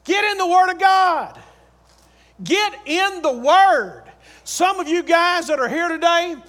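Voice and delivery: loud, high-pitched sermon exhortation; full-force preaching. Real, not acted. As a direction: preach it hard, drawing out the key words.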